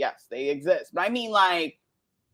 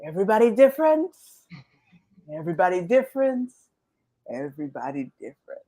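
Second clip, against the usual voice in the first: put-on Jamaican accent; faster than usual. Mother seeing things undone at home, self-soothing Jamaican accent. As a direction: chant it in a Jamaican accent, calming with each repeat. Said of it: "like, a nice Jamaican accent".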